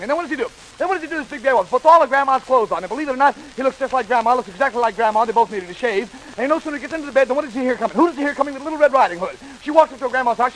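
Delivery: breathless-paced comic patter; as fast as possible. Shown as a demonstration. Storyteller racing on to Grandma's bedside, rapid-fire. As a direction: run the lines together at speed. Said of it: "just as fast as I can tell it".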